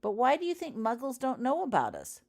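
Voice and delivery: high, loud sneering question; mocking schoolgirl. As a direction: needle her with a falsetto jeer. Slytherin mocking in class.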